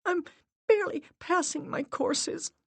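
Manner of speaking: high, tearful pleading; voice breaking. A narrator's reading. Miranda begs her dead mother, tearful and high. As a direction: plead while crying. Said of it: "Miranda's voice broke".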